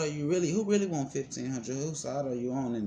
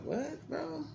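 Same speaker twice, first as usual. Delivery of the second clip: incredulous; 6 dB quieter than usual. Retold or imitated. Stunned reaction to lawyer.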